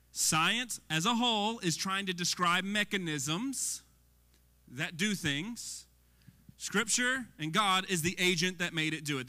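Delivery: normal talking voice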